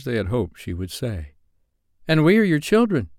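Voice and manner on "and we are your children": high, lightly voiced children; a touch of childlike excitement; eager cry. A narrator's reading. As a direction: call out hopefully in a raised pitch. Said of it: "cried the twins".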